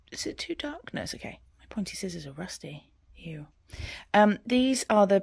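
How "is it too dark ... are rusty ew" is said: whispering. Real, not acted. Aside to herself, unvoiced.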